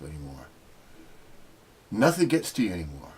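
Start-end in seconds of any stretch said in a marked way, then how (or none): none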